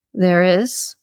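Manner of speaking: usual speaking voice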